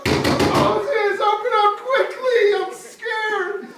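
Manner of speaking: high, loud, slow frantic call; panicked calling. Acted. Pharaoh at Moses' door at night, panicked. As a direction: hammer on the door in fear.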